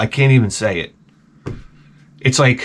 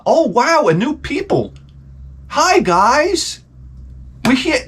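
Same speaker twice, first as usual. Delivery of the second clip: higher, a hammed-up wide-eyed wonder; mock naive delight; 12 semitones higher, slower than usual. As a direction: play the gushing settler greeting the natives. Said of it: "Not, not".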